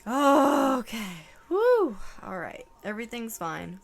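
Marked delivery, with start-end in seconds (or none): 1.5-2.0 loud high woo; high shaken woo. Real, not acted. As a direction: release the strain. Overwhelmed during reading.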